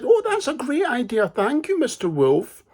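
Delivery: put-on high, thrilled little-girl thanks; delighted little girl. Girl thanks the wolf, high and delighted. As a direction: gush with delight like a trusting child.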